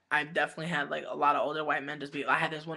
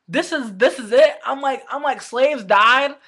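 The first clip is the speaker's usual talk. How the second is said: shouted high and loud, furious; outraged yell; 8 semitones higher, 13 dB louder, slower than usual. Retold or imitated. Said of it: "And I'm just like".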